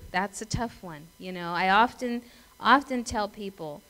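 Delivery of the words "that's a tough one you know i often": usual speaking voice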